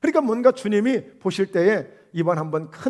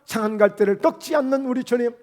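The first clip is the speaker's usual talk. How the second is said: impassioned preaching; 3 semitones higher than usual. Acted. Impassioned preaching on bruised reed.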